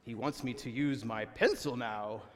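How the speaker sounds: drawn-out, hammed-up spiritual realization; milk it like a silly sign from God; mock revelation. Mocking someone over-spiritualizing a dropped pen, mock revelation. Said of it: "don't sit there and go".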